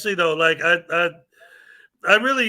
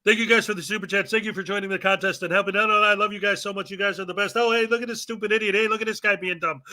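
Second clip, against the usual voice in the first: quick recap. Shown as a demonstration. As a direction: race through it like a summary. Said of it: "do the quick version".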